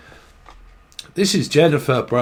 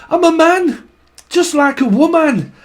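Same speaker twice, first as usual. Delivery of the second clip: put-on exasperated elderly husband; 5 semitones higher than usual. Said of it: "This is an elderly couple, I'm sure".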